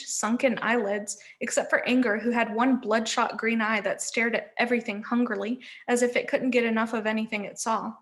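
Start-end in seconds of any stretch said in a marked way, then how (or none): none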